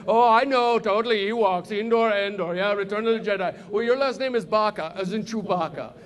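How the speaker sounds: imitated Indian-accented retort; mock know-it-all with Indian accent; Indian student. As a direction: rattle it back in an Indian accent. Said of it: "He's like".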